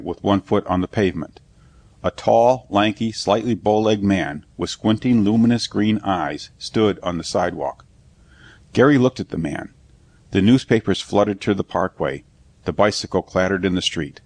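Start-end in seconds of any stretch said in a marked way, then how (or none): none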